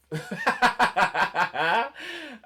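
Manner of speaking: high, loud burst of laughter; loud real laughter. Real, not acted. Laughing at own performance, loud cackling laugh. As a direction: crack up helplessly.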